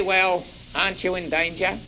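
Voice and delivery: normal talking voice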